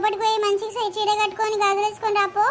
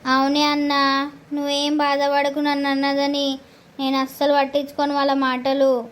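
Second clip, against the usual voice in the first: soothing sister; 6 semitones lower than usual. Acted. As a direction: reassure him in a lower voice. Sister soothes her brother.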